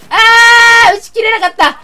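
high, loud cry of frustration; frustrated scream. Real, not acted. Player fails to finish the shark off, screaming in frustration. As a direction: wail at the missed chance.

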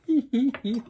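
a burst of high, loud laughter. Real, not acted. Vlogger laughs at the ruined cut, high and loud. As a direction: crack up at the botched haircut. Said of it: "This is terrible".

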